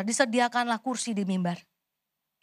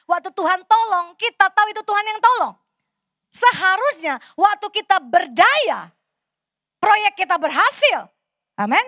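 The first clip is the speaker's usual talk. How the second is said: preaching at full force; 3 semitones higher, 5 dB louder than usual. Acted. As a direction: drive the point home to the congregation.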